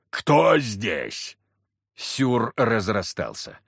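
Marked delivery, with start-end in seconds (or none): 0.1-1.4 gruff giant's question; giant asking. A narrator's reading. Giant asks who is hiding in the hut, gruff. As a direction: demand it of the empty room.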